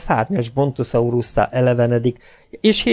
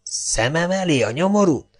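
stifled angry argument; 6 semitones higher than usual. A narrator's reading. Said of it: "folytottan is harsogva vitatkozott".

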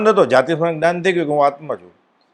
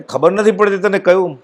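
a scolding king, raised high; angry king; 7 semitones higher than usual. Retold or imitated. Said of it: "એટલા ખીજાય છે રાજા". (રાજા ગુસ્સામાં, ઊંચા અવાજે ઠપકો)

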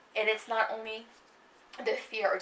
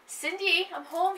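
calling out; 9 semitones higher, 6 dB louder, slower than usual. Acted. Husband calling out to wife.

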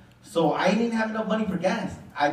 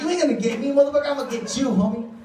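cocky higher-pitched threat; tough taunt; 6 semitones higher, faster than usual. Retold or imitated. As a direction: challenge the catfisher. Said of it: "I'm like".

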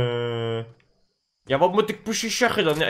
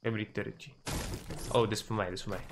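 much softer, almost whispered; hushed voice; 8 semitones lower, 16 dB quieter, faster than usual. Real, not acted. Sneaking player muttering quietly to his team. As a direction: keep it under your breath. Still sneaking near enemy.

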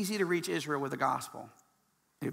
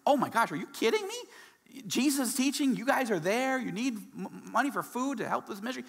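higher, eager gasp of delight; can't believe the good news; gushing, over-the-moon reaction; thrilled disbelief; 7 semitones higher than usual. Retold or imitated. Imagined thrilled call with Peter.

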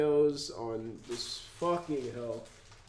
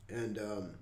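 normal talking voice

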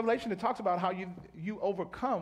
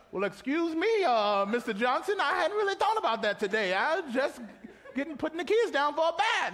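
laughing, higher and louder mock reply; flustered homeowner; 8 semitones higher, 5 dB louder than usual. Preacher as homeowner answering the evangelist, laughing. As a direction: play the caught-off-guard parent.

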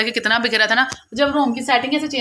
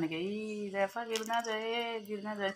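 hushed worry; 18 dB quieter, slower than usual. Real, not acted. Worried the child will spill.

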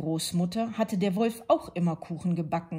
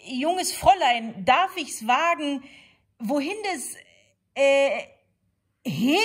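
higher, louder wolf, losing his line; a smooth greeting that falls apart mid-phrase; courtly wolf stumbling over his words; wolf's faltering charm; 9 semitones higher, 8 dB louder than usual. A narrator's reading.

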